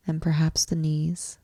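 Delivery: ordinary talk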